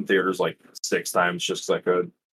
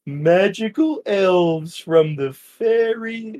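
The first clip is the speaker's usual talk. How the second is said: lightly voiced movie character, higher and slow; a hint of the Cat in the Hat's silly drawl; quoting the Cat; 9 semitones higher, much slower than usual. Host quotes his favourite movie scene in a mild put-on voice.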